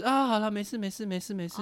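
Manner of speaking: calm her down like a dad; lightly voiced comforting father; imitating a soothing father. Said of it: "就这样安慰他".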